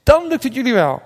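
high-pitched encouraging call. A narrator's reading. Jesus assures the fishermen from shore, calling out. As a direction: keep calling to the boat. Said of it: "riep Jezus".

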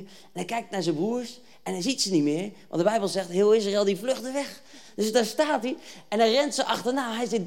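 usual speaking voice

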